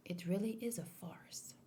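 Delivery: a hushed whisper to yourself; quiet whispered aside. Real, not acted. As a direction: murmur it under your breath. Whispered agreement while watching.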